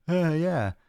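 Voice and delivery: high, blank mock gym guy; a lightly voiced clueless gym bro; dumb reply. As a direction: play it dim and empty.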